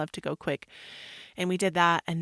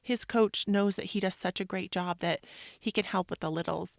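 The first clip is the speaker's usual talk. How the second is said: ordinary talk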